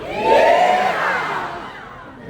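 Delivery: high, drawn-out whoop; long yeehaw cheer. Shown as a demonstration. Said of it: "I need every single one of you to go".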